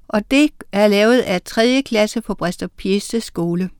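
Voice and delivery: ordinary delivery, nothing marked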